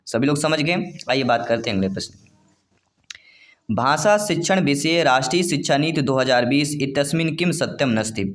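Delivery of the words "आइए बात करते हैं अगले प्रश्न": brisk teacher moving on fast; quick hurried transition. Real, not acted. Teacher to exam students, hurrying on, quick and brisk. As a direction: rattle off आइए बात करते हैं quickly, falling at the end.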